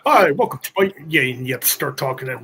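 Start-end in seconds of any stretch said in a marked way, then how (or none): 0.1-0.9 stiff robotic YouTuber greeting; robot voice, higher and louder. Pretend. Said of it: "a robotic voice".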